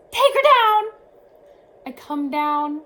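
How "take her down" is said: loud high urgent shout; nurse yelling. Retold or imitated. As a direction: yell the order much higher and louder. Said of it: "the nurse yells".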